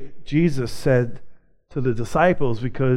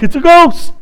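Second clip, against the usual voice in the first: high, loud cry of alarm; hammed-up panic at a sudden sight; mock freak-out; 12 semitones higher, 8 dB louder than usual. Disciples seeing Jesus alive, mock freak-out. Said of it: "They were, they were freaking out".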